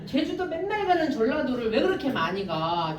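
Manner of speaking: ordinary talk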